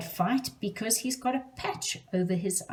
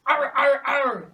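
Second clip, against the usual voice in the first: loud pirate growl; teacher showing kids the pirate ar; 7 semitones higher, 8 dB louder than usual. Shown as a demonstration. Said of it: "can you do that too".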